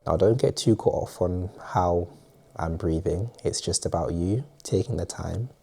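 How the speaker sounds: soft, calm guiding voice with spacious pauses; guided meditation. Acted. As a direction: lead them quietly into breathing and rest. Leads a breathing exercise.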